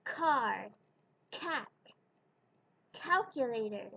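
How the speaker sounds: car, cat, calculator with a puff on each hard c; aspirated English k. Shown as a demonstration. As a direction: push air on the c. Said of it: "same with the hard C".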